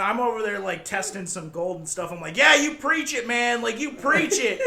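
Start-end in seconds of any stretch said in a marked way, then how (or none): 2.4-4.6 egging on. Retold or imitated. Egging on the customer.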